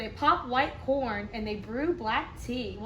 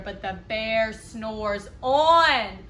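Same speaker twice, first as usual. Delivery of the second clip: louder, slow, stretching the last word; slow drawn-out refrain; 2 semitones lower, 6 dB louder, much slower than usual. A narrator's reading. Reader drags out the refrain for the children, slow and loud. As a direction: draw the refrain out like a sleepy bear.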